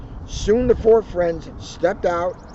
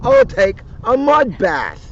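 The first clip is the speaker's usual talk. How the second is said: higher, louder, slow delighted announcement; joyful cry; 6 semitones higher, 6 dB louder, slower than usual. Acted. Tigger proudly announces his mud bath, slow and joyful. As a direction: savour the plan with glee. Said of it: "Tigger cried with joy".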